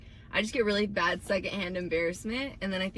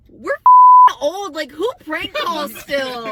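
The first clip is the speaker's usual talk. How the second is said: loud, slow exasperated cry; loud stretched-out disbelief; mock outburst; 8 semitones higher, 14 dB louder, slower than usual. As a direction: yell it like you can't believe it, slowing down. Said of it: "I was like".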